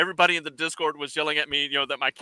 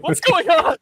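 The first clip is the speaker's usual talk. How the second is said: much higher, louder imitation of baffled players; mock bewilderment; 14 semitones higher, 6 dB louder, slower than usual. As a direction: cry out like a hammed-up confused player. Mimics the bewildered Discord players.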